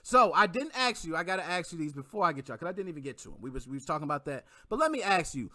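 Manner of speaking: ordinary talk, nothing marked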